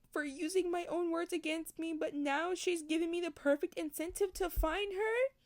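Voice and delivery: normal talking voice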